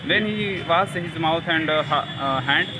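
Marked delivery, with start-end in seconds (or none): none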